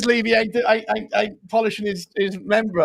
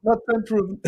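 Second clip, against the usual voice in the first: higher-pitched attempt at his accent; Eugene; 5 semitones higher than usual. Co-host trying a regular's accent, higher put-on voice. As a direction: mimic the catchphrase in a put-on accent. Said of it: "can we do a Eugene accent?".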